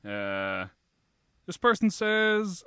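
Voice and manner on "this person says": ordinary delivery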